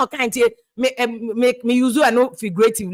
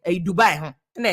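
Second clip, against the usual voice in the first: ordinary talk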